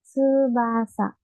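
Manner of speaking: "tsubasa" drawn out slowly, syllable by syllable; slow teaching demo; slow spelled-out word. Shown as a demonstration. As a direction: stretch "tsubasa" for the students. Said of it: "por ejemplo".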